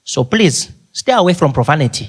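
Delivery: put-on Zambian-accented chaperone; Ali Nani. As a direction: advise earnestly in a Zambian accent. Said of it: "He says".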